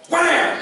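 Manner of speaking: high sudden wham; mock impact noise; imitated punch sound. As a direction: snap it out high. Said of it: "she was like".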